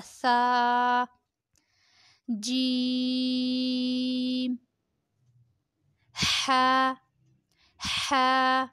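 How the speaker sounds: letter names held on a level tone; drawn-out letters. Shown as a demonstration. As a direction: stretch each letter slowly and evenly. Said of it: "پانچ انگلیوں کے برابر کھینچیں گے".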